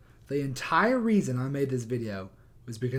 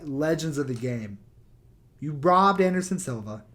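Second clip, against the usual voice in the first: ordinary talk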